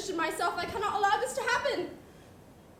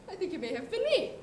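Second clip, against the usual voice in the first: ordinary delivery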